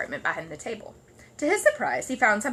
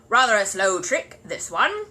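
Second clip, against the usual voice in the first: slow, louder sneering neighbour; sarcastic; 8 dB louder, slower than usual. Acted. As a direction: sneer at the delay. Mr Curry sneering.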